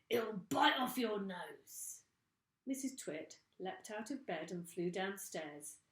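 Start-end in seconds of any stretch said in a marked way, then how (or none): 0.1-2.0 put-on menacing old man. A narrator's reading. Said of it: "Mister Twit said".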